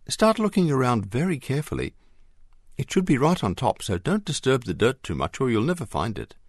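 urgent hopeful directions; excited instructions. A narrator's reading. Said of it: "came Mary's excited voice".

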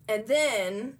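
ordinary talk

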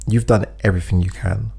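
normal talking voice

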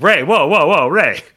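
loud, high-pitched mock alarm; hammed-up panicked plea to settle down; alarmed placating. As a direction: rein in a ranting friend, voice jumping up. Retelling himself calming Ray down.

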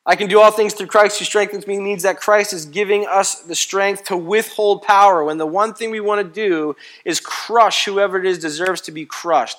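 loud, high, driving preacher's cadence; preaching at full force. Acted. Pastor rousing the congregation, full-force preaching. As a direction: hammer each not about home to the church. Preacher repeats the verse loudly.

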